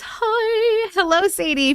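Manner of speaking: a cartoonish high greeting in a special persona voice; playful squeaky persona hi; anti-matrix voice. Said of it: "In my anti-matrix voice".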